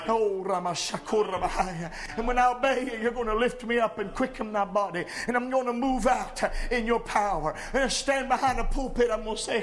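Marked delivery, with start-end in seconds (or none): none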